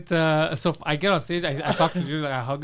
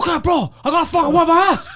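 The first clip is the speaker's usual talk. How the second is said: high, loud, fast, laughing yell; impatient bouncer; 11 semitones higher, 7 dB louder than usual. Retold or imitated. Poseidon yells from the toilet, high and laughing. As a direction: bark it out from behind a door, cracking up.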